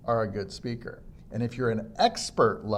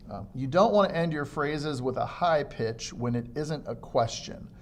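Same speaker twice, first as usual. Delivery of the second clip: usual speaking voice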